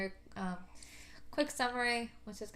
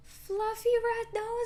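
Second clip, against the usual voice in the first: excited; 14 semitones higher, 6 dB louder, much slower than usual. Real, not acted. Thrilled at fluffy nose idea.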